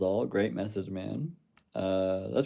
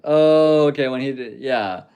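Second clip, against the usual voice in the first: loud, high "oh" settling into agreement; sudden realisation; 5 semitones higher, 10 dB louder than usual. Real, not acted. Streamer finally getting a viewer's comment, sudden loud realisation. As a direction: burst out as the point clicks, then ease down.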